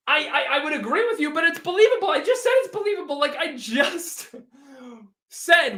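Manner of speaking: high, loud, exasperated retort. Real, not acted. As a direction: scoff that it's believable. Exasperated fan, sarcastic retort.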